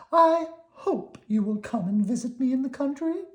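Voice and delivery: country cousin worm, polite. Acted. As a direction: ask very politely, pitched high.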